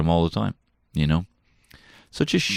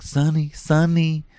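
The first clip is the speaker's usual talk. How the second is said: sing-song waking call; 12 semitones higher, 5 dB louder, slower than usual. Retold or imitated. Dad waking son gently.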